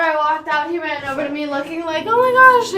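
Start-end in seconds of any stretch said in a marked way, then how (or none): 2.1-2.7 high, loud burst of excitement; excited dog's voice. Acted. Voicing Milo running over to the owner, excited. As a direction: exclaim it as an overexcited dog, much higher and louder. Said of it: "Milo got so excited".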